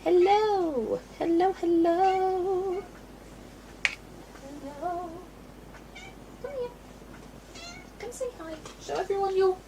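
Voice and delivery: very high sing-song coaxing; high-pitched, softening pet talk. Real, not acted. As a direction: coax a pet over in a high voice.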